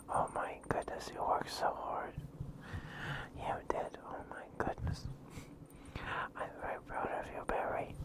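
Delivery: normal talking voice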